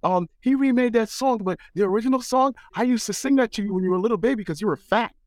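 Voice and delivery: high, quick teasing mom voice; mother voice. Retold or imitated. Mother explaining the original song, high and quick. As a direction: tease him like his mother did.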